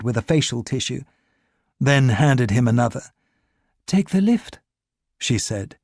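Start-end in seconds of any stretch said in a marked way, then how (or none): 3.9-4.6 higher, helpful urging voice; saleswoman. A narrator's reading. Saleswoman urging Ricard to escape, higher voice. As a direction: lift the pitch for her tip.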